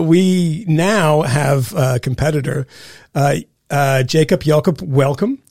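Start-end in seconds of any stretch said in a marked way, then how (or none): none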